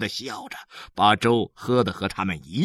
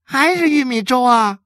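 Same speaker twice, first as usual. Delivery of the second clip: put-on loud, high whine of let-down kids; high childish complaint; disappointed children; 11 semitones higher, 6 dB louder than usual. A narrator's reading. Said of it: "二乐和三乐也跟着同样失望地说".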